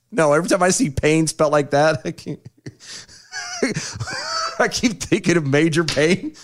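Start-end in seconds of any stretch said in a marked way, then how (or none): 3.3-4.5 high-pitched burst of laughter. Real, not acted. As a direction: crack up into a squeal. Laughing at Payne name.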